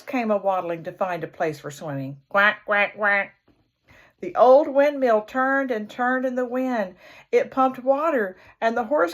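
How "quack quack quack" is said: duck quacking. A narrator's reading. Ducks quacking.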